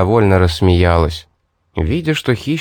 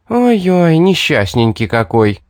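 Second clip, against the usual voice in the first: high mock-pitying coo sliding down; exaggerated derisive pity; scornful snort; 6 semitones higher than usual. A narrator's reading. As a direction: tease with fake sympathy. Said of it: "зафыркала хищница".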